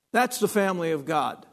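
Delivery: ordinary talk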